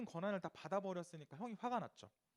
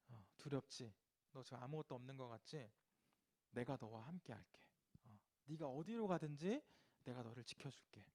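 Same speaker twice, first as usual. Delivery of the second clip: tender reassurance; 5 semitones lower, 7 dB quieter than usual. Acted. God comforting Jacob softly.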